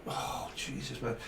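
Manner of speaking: fed-up carer muttering to self; muttered exasperation. Retold or imitated. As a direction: grumble it under your breath. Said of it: "And you're like".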